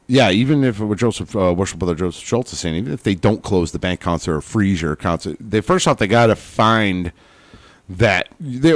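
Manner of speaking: ordinary talk, nothing marked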